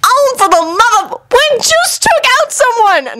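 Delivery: high-pitched exasperated whine; exasperated squeaky gripe; complaining. A narrator's reading. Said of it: "Naruto complained".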